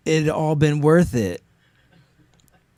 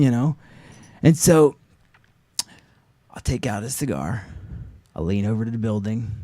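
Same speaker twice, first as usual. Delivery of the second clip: ordinary talk, nothing marked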